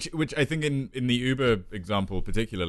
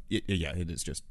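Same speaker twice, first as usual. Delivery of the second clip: quiet; 6 semitones lower, 11 dB quieter, faster than usual. Acted. Sheepish admission.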